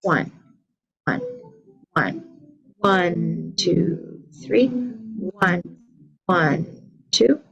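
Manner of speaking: steady counting the beat aloud; counting along. Shown as a demonstration. Teacher counting rhythm while the class claps. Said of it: "same thing".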